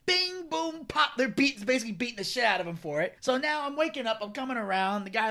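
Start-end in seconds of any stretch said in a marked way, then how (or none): 0.1-1.1 higher mock punch noises; mock punch sounds. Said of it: "Then he goes".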